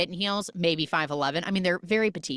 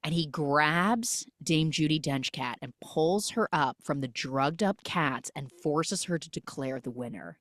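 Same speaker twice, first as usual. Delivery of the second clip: ordinary talk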